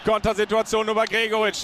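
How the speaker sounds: usual speaking voice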